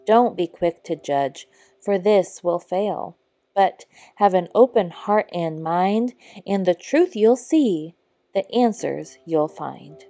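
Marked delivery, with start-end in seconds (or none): none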